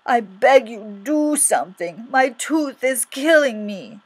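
loud, drawn-out begging; crying out. Acted. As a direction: plead in agony, stretching the words. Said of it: "he cried".

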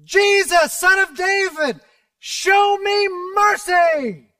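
loud, high desperate cry for mercy; shout. A narrator's reading. Blind beggar shouting to Jesus from the roadside, loud desperate cry. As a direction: call out desperately to be heard. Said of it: "he began to shout".

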